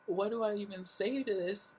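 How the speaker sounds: high, pleading and lost; retold helplessness. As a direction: ask it high, at a loss. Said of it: "I was like".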